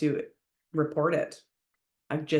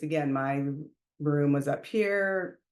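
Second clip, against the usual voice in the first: usual speaking voice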